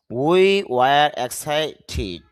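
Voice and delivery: we were excited drawn out slowly; careful teacher model; slow and clear; slow demo. Shown as a demonstration. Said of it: "শুদ্ধ উচ্চারণটা হবে excited".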